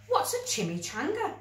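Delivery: lightly voiced puzzled boy; puzzled boy Billy. A narrator's reading. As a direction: ask it like a baffled ten-year-old. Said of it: "asked Billy".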